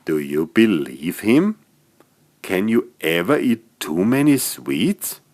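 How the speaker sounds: plain reading voice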